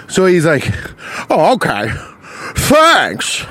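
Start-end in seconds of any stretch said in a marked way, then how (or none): 1.3-3.5 hammed-up grateful older man; Jimmy Stewart. Line-cutter thanking the man behind, Jimmy Stewart impression. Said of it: "I'm doing a Jimmy Stewart".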